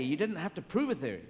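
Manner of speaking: ordinary talk, nothing marked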